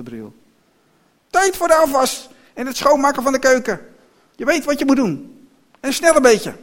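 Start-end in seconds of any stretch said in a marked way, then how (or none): 1.3-6.6 high, loud bossy woman; bossy order. A narrator's reading. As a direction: order the child straight to the chores. Vrouw beveelt kind tot werken. (hoog en luid commanderen)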